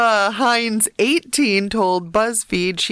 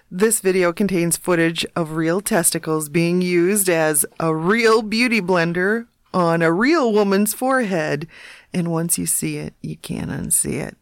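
disclaimer read; 5 semitones lower than usual. Acted. Mock disclaimer warning read.

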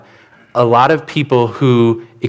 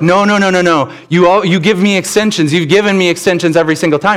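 higher-pitched, acted-out student objection; mock indignant protest; 5 semitones higher than usual. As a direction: protest in rapid repeats, imitating an aggrieved student. Student protests his failing grade.

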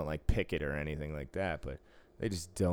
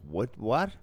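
mock bafflement, pitched up and laughing; retold incredulous reaction; 6 semitones higher, slower than usual. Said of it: "and I'm like".

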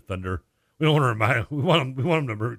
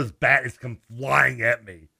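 normal talking voice

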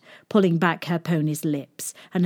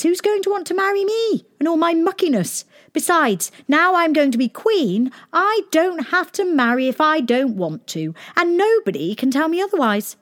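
high, fast defiant princess; cartoon princess; 10 semitones higher than usual. A narrator's reading. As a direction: brush it off, high and quick. Princess refuses a husband.

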